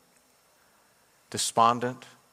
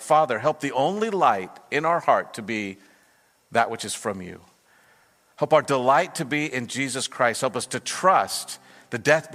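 pastor's fervent closing prayer, louder and rising; earnest prayer; 3 semitones higher, 4 dB louder than usual. Acted. Pastor praying over the church at the close, fervent. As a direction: pray it with rising conviction over the congregation.

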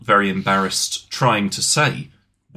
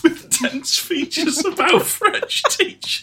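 laughing; slower than usual. Real, not acted. Laughing fits.

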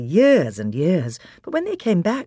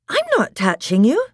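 high, louder defensive retort; indignant denial; 11 semitones higher, 5 dB louder than usual. Acted. Susan answering Peter's accusation, indignant denial. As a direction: deny it hotly.

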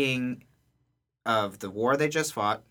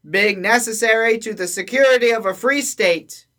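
town crier; 11 semitones higher, 10 dB louder than usual. Pretend. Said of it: "town crier".

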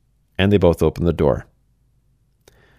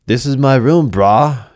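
put-on higher and louder, in a thick Western accent; 5 semitones higher, 4 dB louder, slower than usual. Demon roommate insists it's his room, thick Western accent. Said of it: "he says with a thick Western accent".

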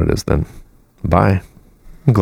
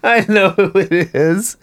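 high teasing copy of her line; mocking echo; 12 semitones higher than usual. Mimics her line, higher.

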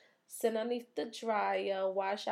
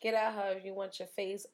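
usual speaking voice

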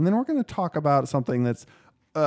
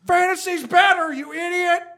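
mock scream. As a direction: scream it out in fake rage. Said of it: "screaming at the top of my lungs".

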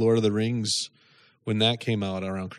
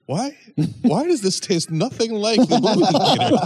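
put-on high, louder kid's protest; baffled kid; 12 semitones higher, 5 dB louder than usual. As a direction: whine it like a disappointed child, pitched way up. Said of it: "at home as a kid".

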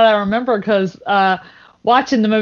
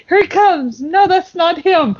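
higher, eager watching voice; eager dad; 8 semitones higher, slower than usual. Retold or imitated. Imitating Larry at the finish line, raised and eager. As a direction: call it out like you're spotting him. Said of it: "But he's like".

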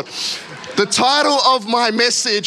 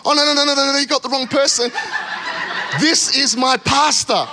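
high, panicked string of no's with a laugh breaking in; a hammed-up frantic protest, slowing on the last line; an officer panicking that they've got her pastor, mock-frantic; 6 semitones higher than usual. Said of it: "and she's panicking".

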